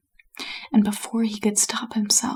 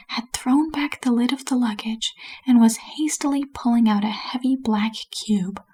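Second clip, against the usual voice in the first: plain reading voice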